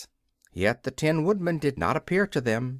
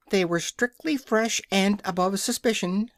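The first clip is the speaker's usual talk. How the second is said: high, clucky offended hen; a proud hen defending her eggs; 8 semitones higher than usual. A narrator's reading. Billina the hen defends her eggs to the King, high and offended.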